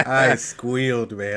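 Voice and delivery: normal talking voice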